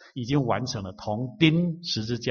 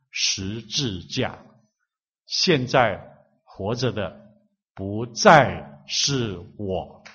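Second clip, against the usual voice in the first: slow reading; 3 semitones lower, much slower than usual. Shown as a demonstration. Pastor shows the congregation how to read a verse aloud, very slow. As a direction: let each word sink in, drawn out slowly. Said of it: "而且要慢慢的读".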